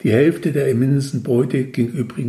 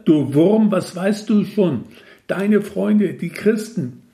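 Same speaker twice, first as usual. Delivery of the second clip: scornful, raised and louder; angry louder; 7 semitones higher, slower than usual. A narrator's reading. God scolds the narrator, louder and scornful. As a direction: lash out at the little worm. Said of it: "wurde das erste Gesicht lauter".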